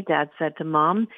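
ordinary delivery, nothing marked

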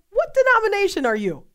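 high, loud, amazed asking; surprised question. Retold or imitated. Host retelling how she asked the missionary friend, amazed. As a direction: blurt it out, astonished.

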